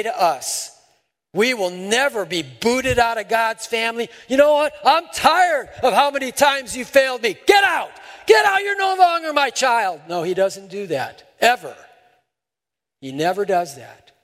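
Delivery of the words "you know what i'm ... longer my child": high, loud shouted rejection; hammed-up furious parent yelling; mock angry rejection. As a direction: shout it like a fed-up parent throwing out a child. God voiced as rejecting parent.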